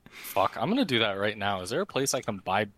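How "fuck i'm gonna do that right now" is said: usual speaking voice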